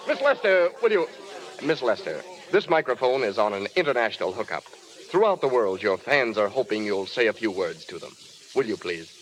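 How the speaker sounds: announcer gently urging the widow to speak; gentle coaxing. Acted. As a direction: coax her kindly toward the mic. Gently coaxing upset star to mic.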